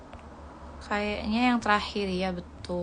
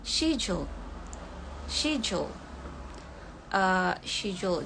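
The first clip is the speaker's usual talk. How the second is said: British accent. Shown as a demonstration. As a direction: say "schedule" as British "shedule". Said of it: "Pengucapannya untuk yang British itu". (logat British)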